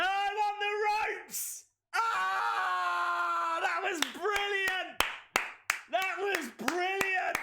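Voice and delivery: high-pitched, drawn-out commentator shout; ecstatic shouting. Real, not acted. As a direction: lose it over a brilliant near finish. Near finish in wrestling commentary.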